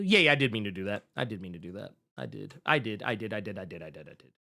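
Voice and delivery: low mutter fading to near silence; muttered self-confirmation. Real, not acted. Host confirming to himself what he meant, fading low mutter.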